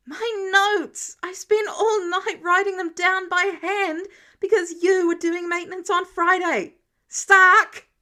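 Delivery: furious shouted rant, near tears; angry yelling. A narrator's reading. Peter yelling at Tony over his burnt notes, furious shout. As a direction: yell it out, fed up and exhausted. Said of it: "grabbing the hammer in anger and yelling".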